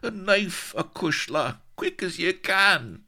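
high, urgent plea; strained, frantic cry in a brogue; anguished. A narrator's reading. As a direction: beg desperately for the knife. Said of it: "dangling in anguish".

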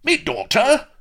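loud, very high astonished question; startled recollection. A narrator's reading. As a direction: blurt it out, suddenly remembering. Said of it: "gaped in sudden recollection".

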